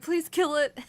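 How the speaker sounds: high, loud begging squeal; mock terrified plea. The trapped girl begs them to kill it, high loud plea. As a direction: plead in fake terror.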